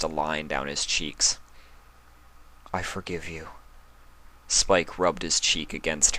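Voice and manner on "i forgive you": much softer, tender whisper-like words; soft forgiveness. Acted. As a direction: forgive her gently, close to her cheek.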